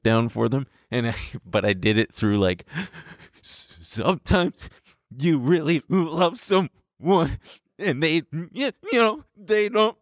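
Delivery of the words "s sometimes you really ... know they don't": put-on tender voice for kids, high and slow; gentle explaining to kids. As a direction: talk heartbreak down gently for kids, pitched high.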